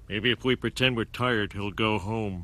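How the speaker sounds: low muttered scheming aside. Acted. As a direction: plot quietly behind his back. Whispered plan to fake tiredness.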